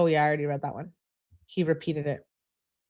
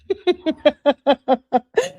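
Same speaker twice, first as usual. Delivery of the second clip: high giggle; 9 semitones higher, 5 dB louder than usual. Real, not acted. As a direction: burst of high, loud giggling. Laughs at viewers' reactions while reading.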